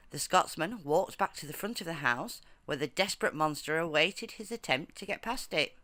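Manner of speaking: plain reading voice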